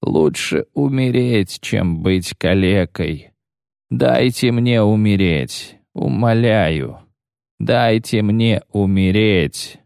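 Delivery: slow, fading pleading; slowing drowsy speech. Acted. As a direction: drift off under a spell, slowly. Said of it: "раненый говорил всё медленнее".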